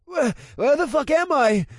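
high, loud, panicked cry; terrified, looking around; panicked man. A narrator's reading.

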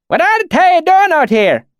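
high, loud challenge in thick rustic dialect; suspicious valley accent. A narrator's reading. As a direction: question strangers warily in a backwoods accent. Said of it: "he said suspiciously".

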